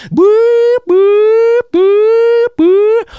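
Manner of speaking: loud, high alarm beeps; high-pitched emergency buzzer noise; imitated alarm. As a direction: hoot four even siren pulses.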